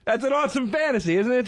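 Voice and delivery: ordinary talk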